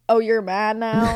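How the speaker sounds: slow, laughing parody of a snide taunt; mock taunt through laughter; laughing mock quote. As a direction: drag it out while giggling. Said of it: "I didn't say".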